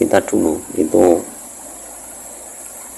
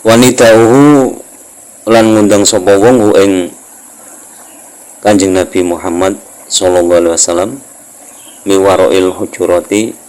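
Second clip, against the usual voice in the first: kitab recitation; 5 dB louder than usual. Acted. Teacher resuming the text before explaining, projected kitab recitation. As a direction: launch the Arabic phrase long and loud, then ease down.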